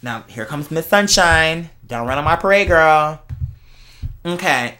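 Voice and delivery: usual speaking voice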